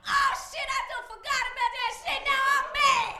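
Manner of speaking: shrieking, laughing realisation; high loud mock outrage. A witness suddenly remembering the cheating, squealing mock fury. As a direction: squeal it up high, getting mad. Latanya getting mad.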